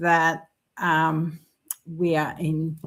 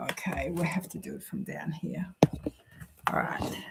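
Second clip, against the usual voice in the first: whispering; 4 semitones lower, 10 dB quieter than usual. Real, not acted. Aside while moving camera down.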